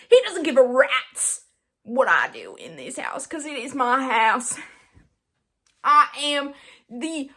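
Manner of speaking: loud, hammed-up proclamation; mock bossy declaration. Claiming rule of the house for laughs, mock-bossy. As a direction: declare it with comic self-importance. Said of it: "this is just me trying to be funny".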